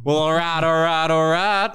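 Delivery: high, drawn-out celebrity catchphrase impression; Matthew McConaughey. Contestant impersonates a film star for an extra point, high and drawn out. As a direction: stretch each repeat, pitched well up. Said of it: "So Matthew McConaughey".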